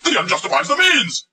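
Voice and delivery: high-pitched mock voice; mocking quote. Mock-quoting the maxim he attacks.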